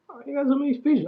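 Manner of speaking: normal talking voice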